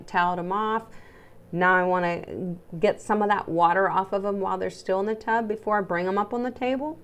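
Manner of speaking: ordinary delivery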